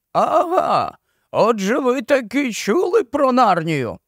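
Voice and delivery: high, slow, odd marsh-wiggle voice; drawn-out, doleful creature of the marshes; gloomy marsh-wiggle. A narrator's reading. Puddleglum contradicts Jill, slow high gloomy marsh-wiggle voice.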